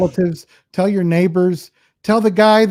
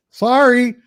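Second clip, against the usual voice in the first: drawn-out Canadian "sorry", pitched up; Canadian-accented "sorry" with a raised pitch; 7 semitones higher, 4 dB louder than usual. Retold or imitated. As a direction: say "sorry" like a Canadian, slow and high. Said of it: "well, you kinda have an accent".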